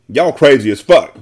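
loud, slow exasperated yell; yelled insult. A narrator's reading. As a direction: yell it with each word drawn out. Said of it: "Daylo yelled".

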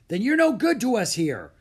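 loud, high dismissive snort. A narrator's reading. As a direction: dismiss them as useless, loudly. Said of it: "snorted the butler".

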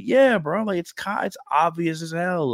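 amused knowing reply. Retold or imitated. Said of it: "I was like".

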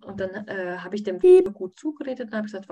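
ordinary talk

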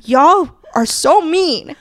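slow, teasing mock scold; mock accusing. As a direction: playfully whine it out. Playful mock scolding, repeated.

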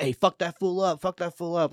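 quoting him egging the crowd on; rapper egging on crowd. Retold or imitated. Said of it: "and he was like".

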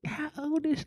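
very soft, nosy wedding whisper; imitated hushed gossip. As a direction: ask it under your breath like a gossiping guest.

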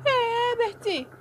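very high, long-drawn mother's cry; high wailing shout; shrieked cry. A narrator's reading. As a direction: stretch the cry out high, calling the name. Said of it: "ela gritou".

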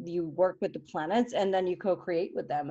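normal talking voice

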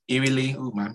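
ordinary talk, nothing marked

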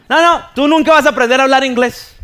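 loud, high mock scolding; exasperated teacher. Teacher telling student he'll never learn, exasperated outburst. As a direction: imitate a teacher giving up on a pupil, loudly.

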